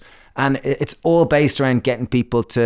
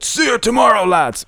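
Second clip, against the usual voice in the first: high, loud chest-thumping tough guy; macho challenge; 12 semitones higher, 3 dB louder than usual. Retold or imitated. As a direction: boom it like a swaggering hard man. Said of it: "in my head, he banged his chest".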